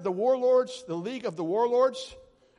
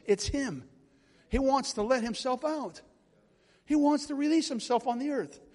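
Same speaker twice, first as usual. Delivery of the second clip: usual speaking voice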